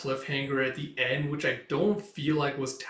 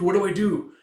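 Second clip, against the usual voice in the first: higher, louder What do I do; a second, more frantic What do I do; rising panic; 6 semitones higher, 7 dB louder than usual. Retold or imitated. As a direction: repeat it with growing panic.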